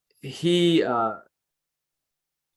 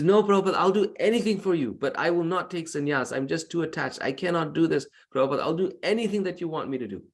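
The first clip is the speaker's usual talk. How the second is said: higher, anxious begging refusal; pleading disciple; 6 semitones higher, faster than usual. Retold or imitated. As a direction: plead with your guru, voice pitched up. Disciple pleads again with guru.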